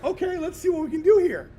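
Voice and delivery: high, loud game plan; eager retold quote. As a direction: pitch it up, eager to try. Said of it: "I'm like".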